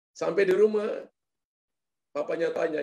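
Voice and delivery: ordinary talk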